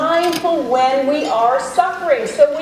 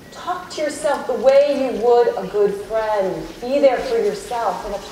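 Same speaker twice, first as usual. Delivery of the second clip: guided meditation. Acted. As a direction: ease the room gently inward, unhurried. Leads a self-compassion meditation.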